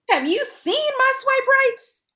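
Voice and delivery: high, loud indignant question; incredulous. Acted. Woman rebutting friend calling her judgy, high and loud. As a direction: fire it back in disbelief. Defending herself as open.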